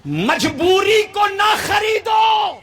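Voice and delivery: high, ringing proclamation; full-force preaching. Acted. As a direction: drive the decree home to the crowd.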